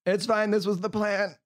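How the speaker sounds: high voiced rogue line; character voice. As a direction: pitch it up as the rogue. Rogue voiced after slapstick fall.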